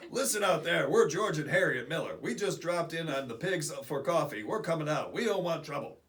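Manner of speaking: loud hailing voice, shouting to those outside; calling out. Acted. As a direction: call out like someone surrounded by police. Reading a comic caption aloud.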